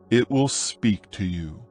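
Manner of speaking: ordinary delivery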